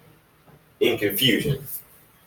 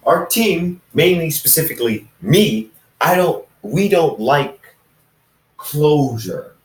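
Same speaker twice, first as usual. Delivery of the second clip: higher, brisk voice of a lone assassin; a shadowy loner talking up his own secrecy; assassin friend speaking; 7 semitones higher than usual. Acted. Lone assassin tells Ethan he shuns attention, higher voice.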